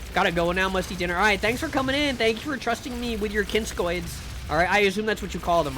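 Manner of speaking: usual speaking voice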